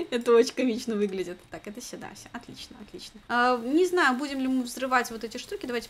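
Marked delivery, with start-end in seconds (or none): 1.5-3.2 whispered. Real, not acted. Quiet relief.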